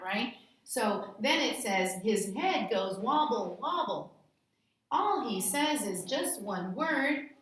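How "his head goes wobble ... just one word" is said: sing-song read-aloud, rising on the gobbles; playful read-aloud for children; story reading. Acted. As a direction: read it like a silly picture book.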